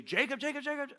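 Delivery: a hint of Rachel screaming; mild mock scream. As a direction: imitate a bound woman crying for help. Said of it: "not scream and say".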